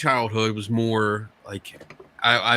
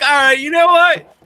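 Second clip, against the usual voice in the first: mock tough guy; 12 semitones higher, 5 dB louder than usual. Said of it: "I'll be like".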